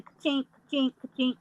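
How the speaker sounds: normal talking voice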